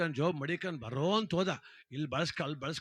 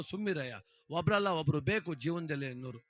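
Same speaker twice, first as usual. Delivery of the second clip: usual speaking voice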